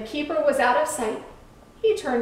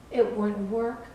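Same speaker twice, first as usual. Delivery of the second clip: ordinary delivery, nothing marked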